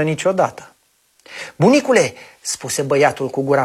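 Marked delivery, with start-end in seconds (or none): 1.6-2.1 put-on child voice. Băiatul cheamă bunicul.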